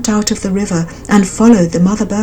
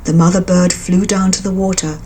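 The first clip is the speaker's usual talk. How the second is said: plain reading voice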